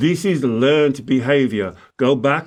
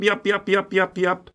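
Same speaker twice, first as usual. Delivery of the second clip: higher, lightly mocking yap-yap; playful mock babble; mocking inner chatter; 4 semitones higher, 3 dB quieter than usual. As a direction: mimic the nagging inner voice, lightly. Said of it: "that inner yap".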